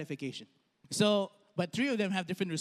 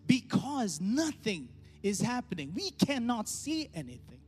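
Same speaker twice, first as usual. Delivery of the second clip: rising sermon delivery; 3 semitones higher than usual. Acted. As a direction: preach it with climbing pitch. Preaching the application.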